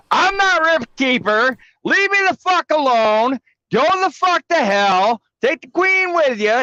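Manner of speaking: high, loud mock fury; fake mad. Streamer showing chat he can fake being mad. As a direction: rant as if furious, but it's an act. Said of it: "I can pretend to be mad".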